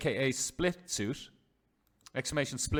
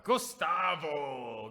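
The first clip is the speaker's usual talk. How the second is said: drawn-out call; much slower than usual. Real, not acted. Calls out the player's name.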